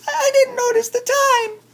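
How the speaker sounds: very high, squeaky little chipmunk voice; tiny chipmunk voice. Acted. Chipmunk greeting Bear at the party, very high squeaky voice. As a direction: pitch way up like a small sweet chipmunk.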